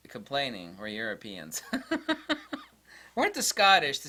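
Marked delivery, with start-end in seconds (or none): 1.7-2.6 high giggle. Real, not acted. Laughs at Europeans remark.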